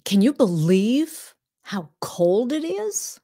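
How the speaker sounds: slow, drawn-out small-talk question; slow chatty question. Shown as a demonstration. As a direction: ask slowly, inviting a reply. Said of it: "example question".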